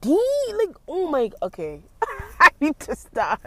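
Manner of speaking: high, loud laughing outburst. Real, not acted. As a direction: lose it laughing at the scene. Laughing at woman outside.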